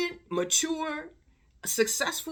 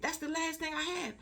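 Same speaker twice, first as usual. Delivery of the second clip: high-pitched retold tearful plea; imitated crying; 8 semitones higher than usual. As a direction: mimic a distraught girl pleading, tearless. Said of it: "the girl is crying".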